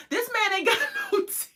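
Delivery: high, laughing through the words; a high giggling punchline; laughing disbelief. Real, not acted. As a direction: crack up mid-line. Cracking up.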